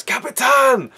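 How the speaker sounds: high, far-carrying shout; calling out. Retold or imitated. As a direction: call back in recognition. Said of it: "And Dersu says".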